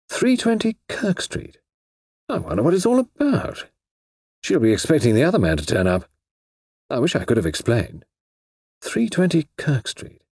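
befuddled author muttering; puzzled Eastwood. Acted. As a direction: wonder aloud, baffled. Eastwood pondering the address.

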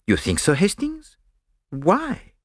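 put-on Poirot with a light French accent. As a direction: ask it as a fussy Belgian detective. Narrator voices Poirot.

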